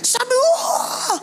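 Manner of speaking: high, slow mock wail; imitating sobbing. Retelling the woman who wept washing his feet, mock sob. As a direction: act out her crying on the wah. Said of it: "nangisnya sampai termehek-mehek".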